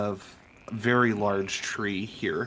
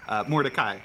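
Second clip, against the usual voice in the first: ordinary talk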